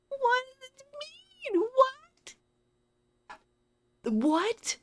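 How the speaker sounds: baffled. Real, not acted. Baffled by the game message.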